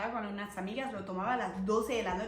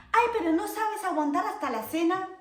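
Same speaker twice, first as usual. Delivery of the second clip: higher, louder reproachful godmother; 8 semitones higher, 6 dB louder than usual. Retold or imitated. Godmother asking if she can't wait for dinner, higher reproach. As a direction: scold her for eating early. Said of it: "me dice".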